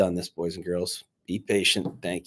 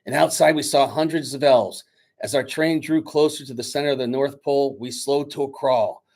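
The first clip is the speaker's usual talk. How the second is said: lively read-aloud storyteller voice; story narration; 5 semitones higher, 5 dB louder than usual. A narrator's reading. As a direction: read the story to the class with energy. Teacher reads story aloud.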